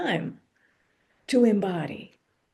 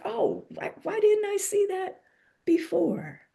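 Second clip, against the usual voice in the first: mock sudden realization; 11 semitones higher than usual. Sudden realization, retold inner voice.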